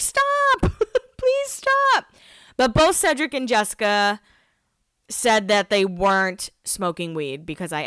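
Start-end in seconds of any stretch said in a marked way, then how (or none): 0.0-2.1 squeaky high laughing plea; high laughing mock plea; pleading lungs. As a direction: beg in a tiny strangled voice. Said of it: "your lungs are like".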